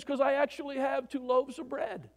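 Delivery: giggling comrade voice; laughing punchline. Retold or imitated. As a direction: deliver the punchline half laughing. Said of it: "He says".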